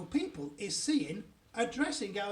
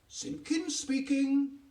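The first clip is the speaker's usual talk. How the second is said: higher, drawn-out telephone answer; father on the phone; 7 semitones higher, 4 dB louder, much slower than usual. A narrator's reading. Father answering an important phone call, stretched and self-important. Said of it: "his voice goes all funny".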